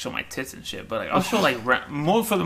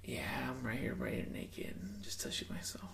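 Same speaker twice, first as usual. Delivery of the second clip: put-on breathy girl voice; 16 dB quieter than usual. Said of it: "try doing a girl voice".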